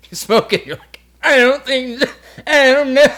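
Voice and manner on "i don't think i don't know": high, loud squealed stoner panic; mock helpless high-pitched babble; freaked-out stoner. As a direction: squeal it like someone way too high. Mimicking being too high on weed.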